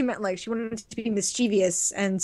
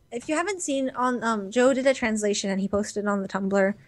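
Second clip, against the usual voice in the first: ordinary talk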